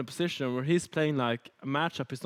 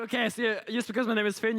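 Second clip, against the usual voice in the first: raised-pitch disbelieving retort; incredulous retort; 7 semitones higher than usual. Retold or imitated. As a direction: say it higher, mock offended. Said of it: "I was like".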